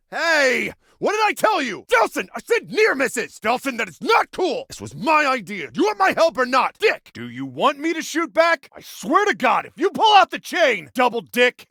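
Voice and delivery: high-pitched indignant yelling; angry scolding. Acted. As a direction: tell him off, outraged and rattled. Scolding Delsin under fire.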